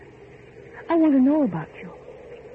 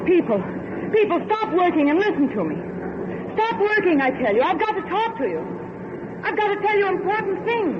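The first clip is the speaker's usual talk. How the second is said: calling out to crowd; 8 semitones higher than usual. Acted. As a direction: call out to be heard by the workers. Addressing noisy crowd of workers.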